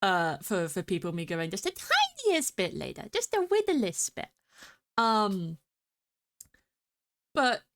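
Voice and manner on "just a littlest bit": put-on high cutesy baby voice; cutesy baby talk.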